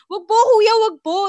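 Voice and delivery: high, loud pleading with the robber, replaying her panic; retold frightened plea. Frightened passenger begging the knife-wielding driver, high and loud. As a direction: beg him not to hurt you, voice up high.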